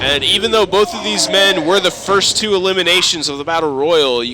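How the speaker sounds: loud play-by-play call; wrestling commentator. Acted. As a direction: call the match to the crowd. Live match play-by-play.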